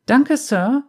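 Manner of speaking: put-on high, delighted child's thanks; beaming little girl. A narrator's reading. Girl thanks Marcin for the milk, high and beaming. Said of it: "strahlte ihn an".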